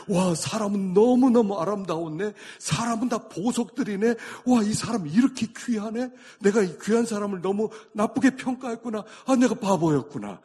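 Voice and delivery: high, loud exclamations of wonder; amazed realization. Acted. Believer newly sees how precious people are, amazed. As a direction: marvel out loud as if seeing people anew.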